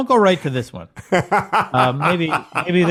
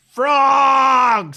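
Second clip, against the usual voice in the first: high, loud mock shout; playful imitation of a shouted word; mock yell; 9 semitones higher, 5 dB louder than usual. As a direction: belt it out like a heckler. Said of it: "did he yell".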